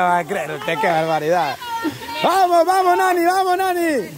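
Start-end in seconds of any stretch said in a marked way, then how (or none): 2.2-4.1 raised-pitch, rhythmic urging; cheering on. Real, not acted. Host urges a player in the race, excited chant. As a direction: spur the player on from the sideline.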